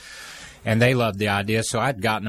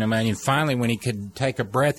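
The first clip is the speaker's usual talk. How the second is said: normal talking voice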